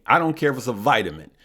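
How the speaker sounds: ordinary talk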